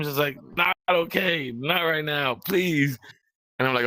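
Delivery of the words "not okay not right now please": higher, begging them to stop; shaky plea. Retold or imitated. Pleading with cousins who pranked him, higher and strained. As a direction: plead like you can't take more.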